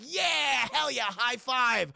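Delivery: high, loud, slow hype; mock excited cheer. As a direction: celebrate like a thrilled kid. [name] cheering.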